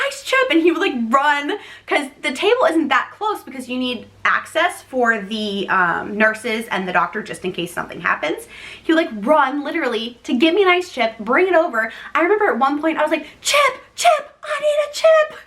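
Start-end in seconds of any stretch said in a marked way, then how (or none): 0.1-0.5 high-pitched urgent demand; mock scream. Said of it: "I just screamed so loud". 13.4-15.4 very high, strained demand; mock scream. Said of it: "I just screamed so loud".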